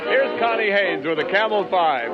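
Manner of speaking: ordinary delivery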